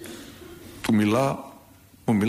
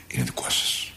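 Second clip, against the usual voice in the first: whisper; 4 dB quieter than usual. Acted. Christ speaks to the children.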